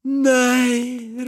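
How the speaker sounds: very high, loud, long-drawn exclamation; delighted cry. A narrator's reading. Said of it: "ropte kona".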